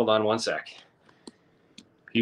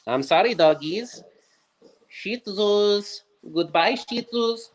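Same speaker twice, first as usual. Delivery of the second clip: much higher sing-song pet voice, slowing at the end; 10 semitones higher, slower than usual. Real, not acted. Saying goodbye to his Shih Tzus in high baby talk. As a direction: coo at the dogs like they're babies. Talking to the family dogs.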